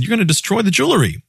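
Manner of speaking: high, fast panicky plea; frightened high-pitched protest; panicked woman. A narrator's reading. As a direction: blurt it out in alarm. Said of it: "She panicked again".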